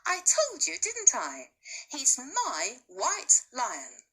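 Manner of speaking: put-on high-pitched, gleeful boy, slowing at the end; triumphant boy. Bertie tells his doubting mother, triumphant and high. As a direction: crow it like a proud child proven right. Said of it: "he cried triumphantly".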